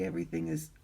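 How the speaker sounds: hoarse, scratchy throat; raspy voice. Real, not acted. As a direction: talk through a raspy throat. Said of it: "I have a raspy voice".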